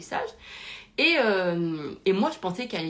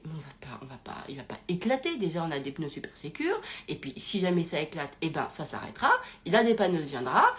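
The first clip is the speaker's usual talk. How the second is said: hyper zen; 5 semitones lower than usual. Retold or imitated. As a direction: imitate an unruffled partner calming someone down. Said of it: "Et lui, hyper zen".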